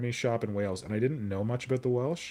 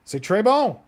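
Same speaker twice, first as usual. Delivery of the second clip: loud, high mock-French crowing; gleeful exclamation; 12 semitones higher, 13 dB louder, slower than usual. Real, not acted. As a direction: crow over your own work, high and loud. Gleefully praising own work.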